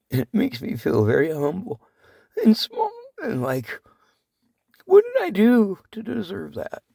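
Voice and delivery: humbled to tears, voice breaking; crying. Real, not acted. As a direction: voice cracking up high through tears. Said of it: "I'm crying".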